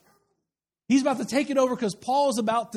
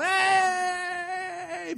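long, high held hail; mock holler to a far-off friend, held high; distant holler; 8 semitones higher than usual. Greeting a friend half mile off, distant holler. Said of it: "half mile off".

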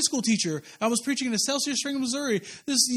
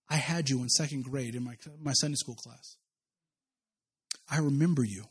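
put-on tender old teacher; 8 semitones lower, 6 dB quieter than usual. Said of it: "And he goes".

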